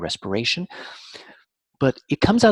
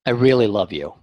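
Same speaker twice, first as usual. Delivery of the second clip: insincere; 3 semitones lower, 5 dB louder than usual. Shown as a demonstration. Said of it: "So if I'm saying".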